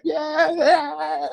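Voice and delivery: high, drawn-out retold yeah; gleeful mock agreement. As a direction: crack up as you imitate yourself agreeing. Retelling own gleeful reply, cracking up.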